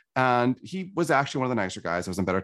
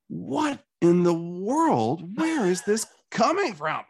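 slow, higher, bewildered inner outburst; retold baffled rage; 8 semitones higher, much slower than usual. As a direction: stretch it out, stunned at his own anger. Retold thought, filled with rage.

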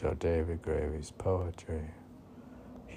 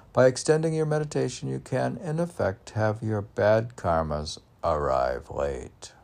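quick, high, bright recital; lively poem reading; 4 semitones higher, 5 dB louder than usual. Acted. Host reads a karma poem aloud, fast and high. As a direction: rattle the verse off fast and high.

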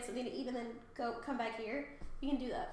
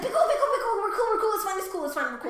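high, loud burst, gradually settling down; acted panicky calming-down, starting high and easing; frantic reassurance; 7 semitones higher, 6 dB louder, much faster than usual. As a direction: hurriedly talk down a screamer. Hermes hurriedly calming a screamer.

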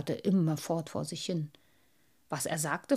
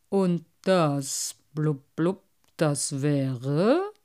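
mocking old carp with burbling blubs; a know-it-all fish looking down on the collection; 2 semitones lower, 3 dB louder, much slower than usual. Carp asks.

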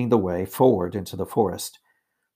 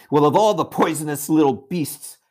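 loud, raised-pitch scolding; indignant outburst; 6 semitones higher, 5 dB louder than usual. A narrator's reading. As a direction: denounce them in disgust, loudly. Peter denounces Edmund's lie.

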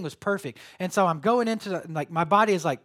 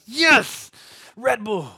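a loud, high yelp of delight; joyful cry; 5 semitones higher, 9 dB louder, much slower than usual. Retold or imitated. Runner spotting the next aid tent, loudly thrilled. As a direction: cheer like you spotted an oasis.

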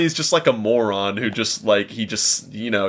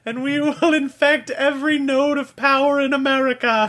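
high villain voice. Acted. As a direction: cackle out world domination. S1 joins the villain voice.

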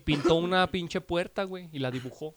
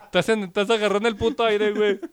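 mock cop shouting; 4 semitones higher, 9 dB louder than usual. Cop yelling at the drunk clinging to the fence, loud mock shout. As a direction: bellow it like an annoyed cop ordering a drunk down.

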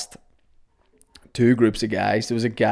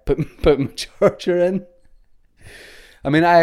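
puffing after rushing about; out of breath; 7 semitones higher, 5 dB louder than usual. Real, not acted. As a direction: speak between quick breaths. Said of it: "great that I'm out of breath".

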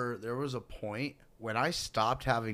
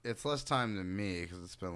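ordinary delivery

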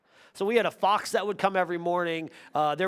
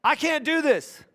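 higher, panicky whine; a preacher voicing a doubting believer, higher and louder; mock anxious cry; 9 semitones higher, 4 dB louder than usual. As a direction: play the scared listener pleading off. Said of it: "You say".

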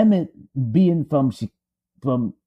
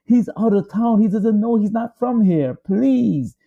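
higher, begging voice; pleading wife; 6 semitones higher than usual. Retold or imitated. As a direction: beg them not to take the boy. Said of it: "she pleaded with them".

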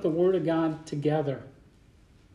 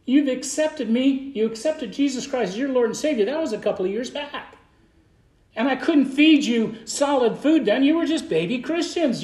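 raised, higher preaching voice driving each question home; 3 semitones higher than usual. Acted. Pastor preaching the scripture to his church, raised and higher.

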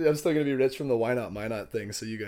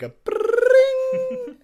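high, loud trilled ring on rolled r; glockenspiel ring; 11 dB louder than usual. Retold or imitated. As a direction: mimic a ringing chime with the voice. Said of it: "I wish I had, like, a glockenspiel. I wanna do, like".

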